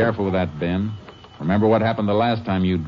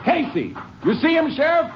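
shouted call across the dark, pitched high; urgent calling out during the chase. Acted. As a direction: yell the name to carry. Chasing the killer, calling out.

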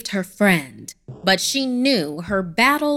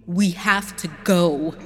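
demanding. Acted. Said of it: "she demanded".

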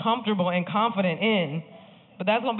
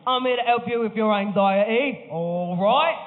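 drawn-out comic Cockney British impression; Cockney accent; 5 semitones higher, slower than usual. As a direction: put on a broad, high-pitched Cockney. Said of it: "had a Cockney British accent".

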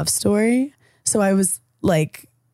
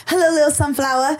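a hint of Harry's charming lilt; imitating Harry; 5 semitones higher than usual. Podcast host imitates Harry's flirty greeting, light mimicry. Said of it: "Harry will literally be like".

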